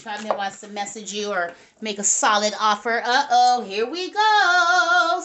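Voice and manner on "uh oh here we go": drawn-out uh-oh. Real, not acted. Card draw turns dramatic.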